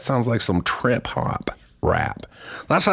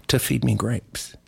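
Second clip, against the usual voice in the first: usual speaking voice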